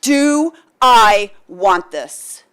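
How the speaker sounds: slow, loud, stress on I; emphatic self-question. Shown as a demonstration. To the audience, a loud slow self-challenge. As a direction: demand it of yourself. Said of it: "ask yourself".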